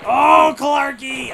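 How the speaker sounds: drawn-out loud cry of a player's name; excited cheer. Real, not acted. Fan cheering his favourite player, slow and loud. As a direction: cheer him on, high and loud.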